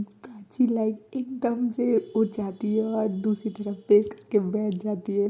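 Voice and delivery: normal talking voice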